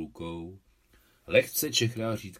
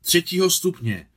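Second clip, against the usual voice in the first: loud, high, excited announcement; breathless excitement; 6 semitones higher, 8 dB louder than usual. A narrator's reading. Feďa runs up with the medal, excitedly calling out. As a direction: call it out high, as if just run up. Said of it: "Přiběhl celý udýchaný".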